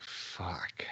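whispered, drained curse; exhausted whisper. Retold or imitated. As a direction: let it out as a tired whisper. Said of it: "I'm like".

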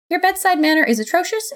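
high, pointed reproach; scolding. A narrator's reading. God scolds Death for losing touch, pointed.

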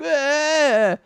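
high mock wail; cartoonish whining bawl; Asta. Asta's cry after scolding.

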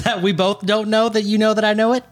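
can barely get the words out for laughing. Real, not acted. Tongue-twister, laughing.

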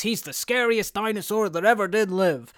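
high, storybook-style boast; playful toy introduction. Acted. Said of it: "he pulls out all of his toys and he goes".

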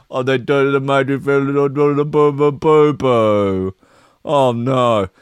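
sing-song mocking ghostly boos; mock spooky voice. Vlogger mocking police over a mean post, sing-song boos. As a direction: taunt like a ghost story.

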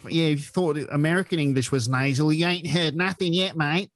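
high, nasal mock Australian; out-twang the Americans in Australian; Australian accent. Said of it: "I love Australian accent too".

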